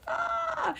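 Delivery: sudden high exasperated yelp; overwhelmed cry. Retold or imitated. Mom swamped by kids' demands, a sharp high yelp. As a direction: let out a frazzled mom shriek.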